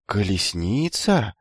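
slow, drawn-out astonished question; mildly amazed youth; surprised. A narrator's reading. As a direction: stretch the word in naive wonder. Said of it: "Юноша удивлённо поднял брови домиком".

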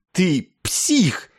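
high, spiteful outcry; angry cry. A narrator's reading. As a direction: fling the accusation out in anguish. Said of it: "выкрикнул Клевенджер". (злобный выкрик сквозь слёзы)

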